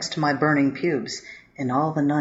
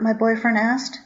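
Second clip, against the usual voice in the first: ordinary delivery, nothing marked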